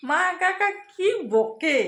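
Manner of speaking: put-on high, slow playful child voice; daughter's voice. Mother mimics her daughter's teasing, high and slow. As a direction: mimic the little girl teasing mum. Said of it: "একা একা ভাবতেছে".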